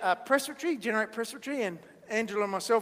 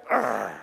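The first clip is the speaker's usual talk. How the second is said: very low, grumbly growl; angry crowd growl. Acted. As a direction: growl deep at someone disliked. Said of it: "Everybody sees Zacchaeus and they go".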